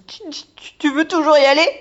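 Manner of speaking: put-on frightened boy stammering; nervous stammer. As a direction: swallow hard and ask. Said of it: "déglutit avant de s'enquérir".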